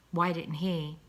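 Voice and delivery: usual speaking voice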